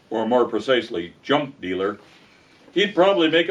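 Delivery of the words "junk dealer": usual speaking voice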